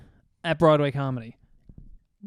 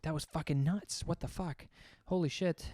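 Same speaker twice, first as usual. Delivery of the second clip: much softer, stunned aside; hushed disbelief; 9 dB quieter than usual. Retold or imitated. To himself after the near-fight, a hushed, stunned aside. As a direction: mutter it under your breath, stunned.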